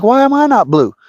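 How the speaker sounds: high-pitched pretend protest; mock complaint. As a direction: plead up high, as if left out. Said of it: "I'm like".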